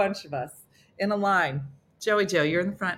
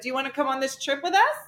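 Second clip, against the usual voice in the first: a little higher, quick eager offer; lightly voiced keen friend; slightly higher, quick and eager; 8 semitones higher, faster than usual. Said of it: "They'd be like".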